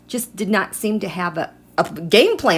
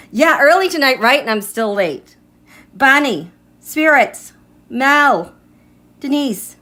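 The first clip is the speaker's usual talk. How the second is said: normal talking voice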